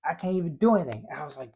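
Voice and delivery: usual speaking voice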